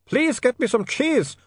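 high, slow pleading vampire wail; desperate moaning. A narrator's reading. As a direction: beg desperately in a high accented voice. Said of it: "he moaned".

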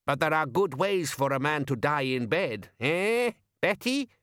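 put-on high bawdy old woman voice; nudge-nudge cackling crone; teasing old woman. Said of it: "She cracked a toothless grin".

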